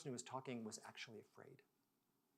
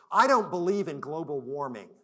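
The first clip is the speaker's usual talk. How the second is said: defiant declaration; 3 semitones higher, 15 dB louder than usual. Retold or imitated. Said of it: "he pulled himself all the way up in his chair".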